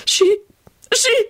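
high, broken sobbing stammer; pitiful high whimper. A narrator's reading. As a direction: stammer it through sobs. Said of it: "began sobbing again".

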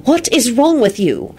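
exasperated scolding question; sharp. Acted. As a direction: stretch the question out crossly. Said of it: "she said sharply".

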